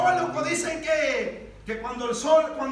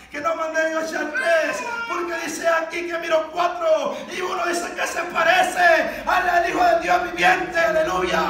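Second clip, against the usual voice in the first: raised, driving preaching voice; full-force preaching; 4 semitones higher, 6 dB louder than usual. Real, not acted. Preacher tells how the men came out unburned, full-force preaching. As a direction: proclaim the miracle with force.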